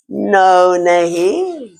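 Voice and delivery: low stubborn refusal; deep rat voice. Acted. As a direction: drop the voice way down and refuse.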